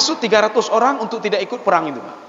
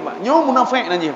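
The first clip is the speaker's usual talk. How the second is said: ordinary delivery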